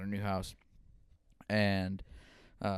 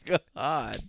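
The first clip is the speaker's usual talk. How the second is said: laughing disbelief; 6 semitones higher, 7 dB louder, much slower than usual. Real, not acted. Stunned at grandpa's remark.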